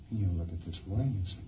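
usual speaking voice

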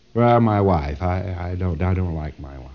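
low, sinking, halting mock answer; acted hesitant patient. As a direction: play a patient reluctantly admitting it, voice dropping away. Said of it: "And he thinks for a moment".

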